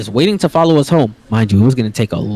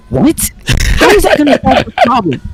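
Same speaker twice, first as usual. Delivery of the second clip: high, exasperated disbelief; incredulous protest; 11 semitones higher, 3 dB louder than usual. Retold or imitated. Family baffled by mom's plan, incredulous protest. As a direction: throw the question back, pitched way up. Baffled reaction to mom, retold.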